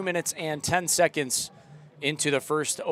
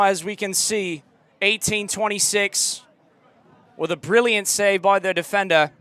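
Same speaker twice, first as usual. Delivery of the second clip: put-on loud English accent commentary, higher; louder mock English commentator; 4 semitones higher, 6 dB louder than usual. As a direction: keep the British broadcaster call going, tense. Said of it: "let it rip".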